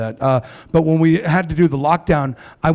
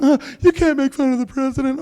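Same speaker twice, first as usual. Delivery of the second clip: a light mocking whine, pitched up; whiny critic; 11 semitones higher than usual. Comic mocks offended fans, light whiny voice. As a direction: mimic an offended scold, lightly. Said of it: "People get so butthurt".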